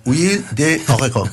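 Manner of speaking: copying huile de orégano in French; a hint of French; imitating French. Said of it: "¿cómo sería en francés aceite de orégano?".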